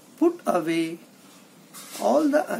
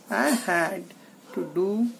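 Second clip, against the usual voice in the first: plain reading voice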